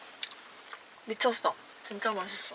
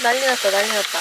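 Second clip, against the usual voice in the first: loud panicked repeating; flustered exclaiming; 9 dB louder, faster than usual. Real, not acted. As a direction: blurt it out in a fluster. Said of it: "진짜 깜짝 놀랐어".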